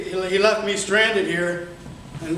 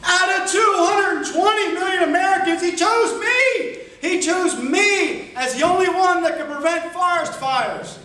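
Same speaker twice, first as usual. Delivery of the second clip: excited shout; 9 semitones higher than usual. Real, not acted. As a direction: shout it out, bursting with pride. Overjoyed, chosen by Smokey.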